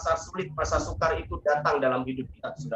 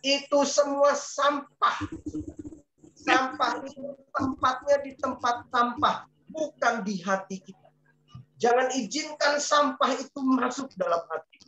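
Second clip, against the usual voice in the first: emphatic preaching; 7 semitones higher than usual. Real, not acted. Preacher climax on rubbish.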